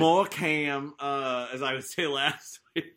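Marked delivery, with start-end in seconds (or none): 0.0-0.9 put-on Texas accent on Morecambe. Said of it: "me affecting a Texas accent for that".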